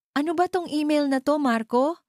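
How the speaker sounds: high, loud accusing yell; angry shout. A narrator's reading. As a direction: shout the question at your husband. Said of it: "sigaw ni Bella".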